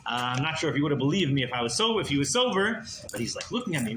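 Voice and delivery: ordinary talk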